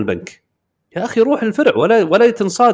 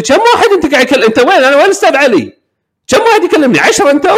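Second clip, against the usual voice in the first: higher, louder, fast indignant rant; mock angry scammer; 9 semitones higher, 5 dB louder, faster than usual. Scammer scolding the caller, fast high mock outrage. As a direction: fire off the complaints fast and high.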